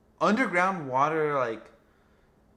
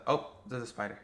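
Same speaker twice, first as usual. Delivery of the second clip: ordinary delivery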